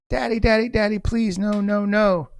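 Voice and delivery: high-pitched imitated child pleading; little daughter. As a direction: plead like a frantic little girl. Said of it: "His little daughter ran out".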